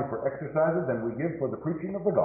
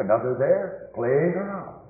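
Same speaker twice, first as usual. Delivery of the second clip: normal talking voice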